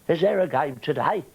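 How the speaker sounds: high comic put-on voice; Norman Wisdom. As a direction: pop in with a daft, high-pitched question. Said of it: "I used to do Norman Wisdom".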